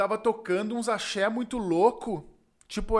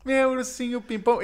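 hammed-up drag queen; 6 semitones higher, 3 dB louder than usual. Drag queen dancing for Jorge on stage, high showy flirting. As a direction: perform it flirtily for the crowd.

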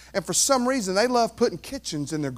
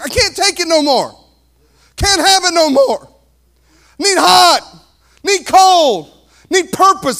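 loud, high, fed-up Jesus; Jesus pleading for purpose, loud; voicing Jesus; 10 semitones higher, 11 dB louder than usual. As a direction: let Jesus sound fed up. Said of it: "And Jesus says".

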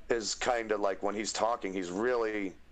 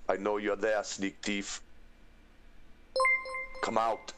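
game guard with a put-on accent; sentry calling out a hidden thief; accented video-game watchman; Skyrim guard. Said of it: "with the Skyrim guard".

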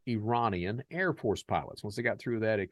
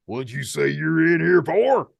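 menacing demand; 8 semitones higher, 13 dB louder than usual. Retold or imitated. Gang circling, menacing repeat question.

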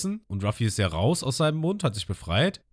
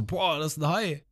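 awed Ruffy; 8 semitones higher than usual. Retold or imitated. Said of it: "Ruffy auch so".

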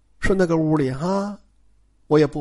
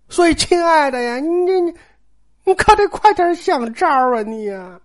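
coaxing wife; 8 semitones higher than usual. Acted. Er Jie coaxing her husband.

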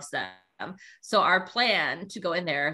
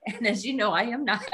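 ordinary talk, nothing marked

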